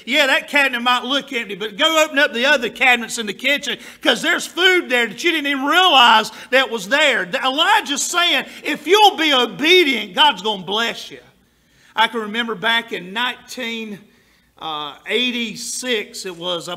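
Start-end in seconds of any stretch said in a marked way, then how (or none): 0.1-11.2 rising louder sermon cadence; preaching building up. Acted. Pastor exhorting congregation, building louder and louder. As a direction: build the promise to a shout.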